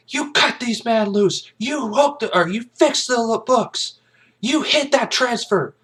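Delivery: high, loud, slow accusation; accusing outburst. Retold or imitated. Kaffee hurls accusations at Jessup, high and loud. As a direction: hammer the colonel with the charge, pitched high. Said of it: "he goes on it".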